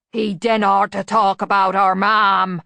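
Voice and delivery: slow, loud, big hulking grumble; a giant son defending his mother; sulky rumble. A narrator's reading. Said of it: "The voice was like an earthquake".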